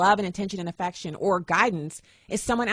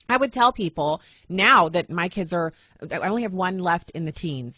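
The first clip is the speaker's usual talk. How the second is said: usual speaking voice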